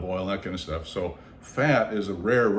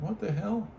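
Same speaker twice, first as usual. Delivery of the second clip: startled whisper-like alarm; 10 semitones higher, 6 dB quieter than usual. Retold or imitated. Noticing the mesh moving.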